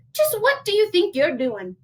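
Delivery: high-pitched motherly telling-off; high, exasperated storybook mother; scolding mama. Acted. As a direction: demand an answer from a naughty child. Mama scolds her son in story.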